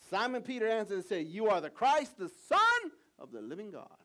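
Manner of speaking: loud, high proclaiming read; preached scripture. Acted. Pastor reading Peter's answer aloud, loud proclaiming. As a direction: proclaim it to the room.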